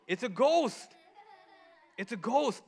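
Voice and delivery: high-pitched mock fright; acted scare, pitch leaping up; frightened cry. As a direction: cry out as spooked disciples. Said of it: "they cried".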